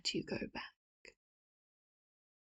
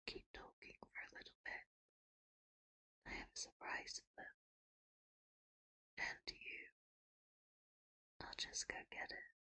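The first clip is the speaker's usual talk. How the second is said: hushed whisper, far softer; whispered aside; 18 dB quieter than usual. Acted. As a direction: whisper off-mic so the nation can't hear. Aside to partner while live.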